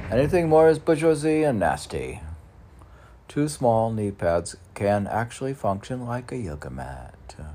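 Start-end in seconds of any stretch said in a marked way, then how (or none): 0.1-2.2 high, loud, quick disdain; scornful pronouncement. Acted. Mocking luxury to viewers, high and scornful. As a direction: sneer it out as a verdict.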